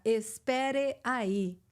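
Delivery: "Espere aí" drawn out syllable by syllable; slow, over-careful. Shown as a demonstration. Teacher imitating lessons for an American, slow=. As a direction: stiff textbook "Espere aí". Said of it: "ensinar um americano a falar".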